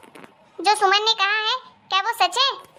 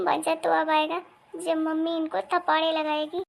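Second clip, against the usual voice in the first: ordinary talk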